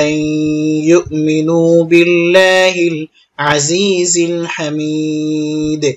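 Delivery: very slow, measured tajweed chanting; Quran recitation. Shown as a demonstration. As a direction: draw out the long vowels and final syllable. Teacher recites the Quran verse.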